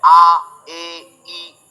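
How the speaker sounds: "आ, ए, ई" each held apart; three distinct vowels, each sounded alone; separate vowel sounds. Shown as a demonstration. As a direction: demonstrate "आ, ए, ई" one by one. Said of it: "तो अलग-अलग sound है".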